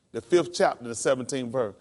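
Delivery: ordinary delivery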